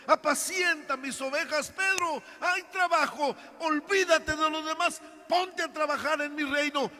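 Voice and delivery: urgent encouragement. Acted. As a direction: rouse him to get back to work. Jesus urging Peter to work.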